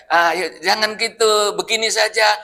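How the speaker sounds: ordinary delivery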